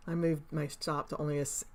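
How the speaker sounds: ordinary delivery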